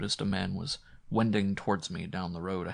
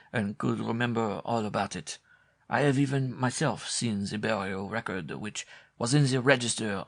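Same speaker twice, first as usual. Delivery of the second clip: French countryman voice. A narrator's reading. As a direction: tell it solemnly, crossing himself. Villager explains the grave.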